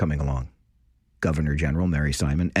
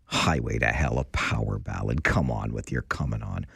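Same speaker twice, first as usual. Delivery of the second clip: mock low grumble; 9 semitones lower, 4 dB quieter than usual. Host mock-pleads after promising closer ear.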